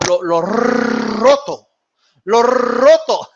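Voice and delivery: "roto" with a long, exaggerated trilled R; rolled R. Mimicking a boxer's Spanish for the stream, long rolled R in "roto". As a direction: stretch the R in "roto" into a long hammy roll. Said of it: "started rolling those R's".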